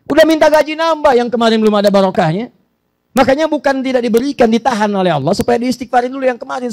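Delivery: high, loud preaching at full force; impassioned sermon voice; fiery preaching. Acted. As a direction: drive the warning home to the congregation. Preacher exhorting congregation.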